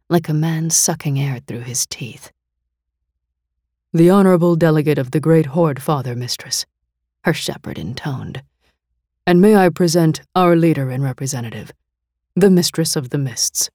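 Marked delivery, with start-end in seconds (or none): 3.9-6.6 louder, quicker announcing voice, formally introducing a guest; formal shepherd herald. A narrator's reading. 9.3-13.7 louder, quicker announcing voice, formally introducing a guest; formal shepherd herald. A narrator's reading. Shepherd presents his leader.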